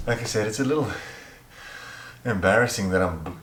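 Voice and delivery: ordinary talk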